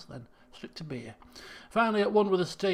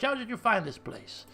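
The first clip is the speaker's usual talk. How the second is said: ordinary talk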